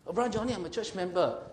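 high, quick mock protest; protesting church member. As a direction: imitate a church member making excuses. Voices an objecting church member.

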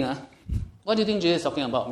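normal talking voice

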